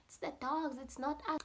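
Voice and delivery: high-pitched protest; imitated denial. Boys telling their dad it was the dogs, denying. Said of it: "Now they're denying".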